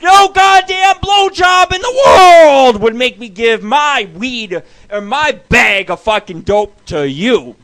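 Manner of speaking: high, loud, slow outraged yell; indignant shout. Retold or imitated. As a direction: bellow it like she asked for the impossible. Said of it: "It's like".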